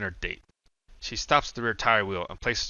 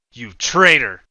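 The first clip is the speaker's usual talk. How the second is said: much louder accusing yell; 2 semitones higher, 14 dB louder, slower than usual. A narrator's reading. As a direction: spit the accusation. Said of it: "shakes his head and yells".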